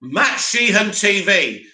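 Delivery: usual speaking voice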